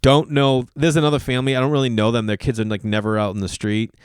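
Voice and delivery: ordinary talk, nothing marked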